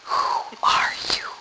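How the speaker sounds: whispered question in awe; awed whisper. A narrator's reading. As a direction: whisper it to the unseen presence. Awed boy questions unseen voice.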